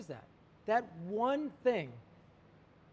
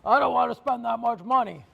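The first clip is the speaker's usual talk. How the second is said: louder, higher grumbling protest; mock husband complaint; 4 semitones higher, 11 dB louder than usual. Husband refusing to spend money, loud mock protest. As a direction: play the stingy husband balking loudly at the price. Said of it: "The husband was like".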